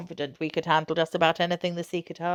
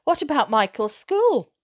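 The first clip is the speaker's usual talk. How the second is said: higher, louder, slower question; a mother raising a doubt; mother's worried query; 7 semitones higher, 7 dB louder than usual. Acted. Mother asks.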